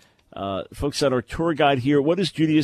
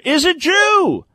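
much higher, louder, slow mocking reveal; mock sinister punchline; 7 dB louder, slower than usual. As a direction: stretch it out like a scandalous revelation, ridiculing it. Punchline of the mocked article.

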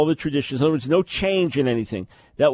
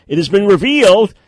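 a hammed-up sinister announcement, higher and louder; mock dramatic reading; 8 semitones higher, 11 dB louder than usual. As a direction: read it like a sensational exposé, mocking it. Host reads hostile article mockingly.

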